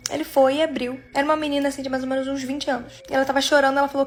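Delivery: plain reading voice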